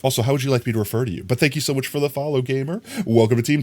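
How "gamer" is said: ordinary delivery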